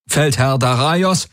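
high, drawn-out urgent hail; warrior reporting. A narrator's reading. Warrior calling his field commander, urgently. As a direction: hail a commander over the comm. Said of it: "meldete einer seiner Krieger".

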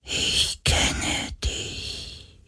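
very slow, faint ghostly whisper; a spirit whispering inside someone's mind. A narrator's reading. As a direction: whisper it drawn out, barely there. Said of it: "flüsterte eine Stimme durch seinen Verstand".

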